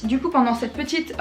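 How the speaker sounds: normal talking voice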